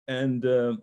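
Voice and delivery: ordinary delivery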